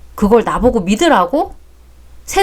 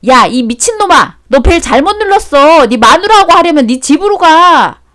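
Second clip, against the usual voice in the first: loud, higher furious scolding; shouting in outrage; angry yell; 5 semitones higher, 5 dB louder than usual. Acted. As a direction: chase the drunk away. Yelling at the wrong-door drunk.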